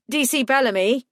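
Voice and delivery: high, rising warning. A narrator's reading. Owen warning the detective about Sullivan, rising warning. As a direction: call her name as a warning. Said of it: "he said in warning".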